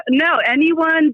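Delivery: ordinary delivery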